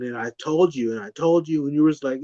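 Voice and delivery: usual speaking voice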